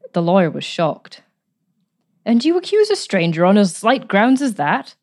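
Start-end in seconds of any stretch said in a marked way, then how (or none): 2.3-5.0 a mild reproachful rise; lightly voiced reproach. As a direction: gently reproach the woman. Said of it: "he reproached her".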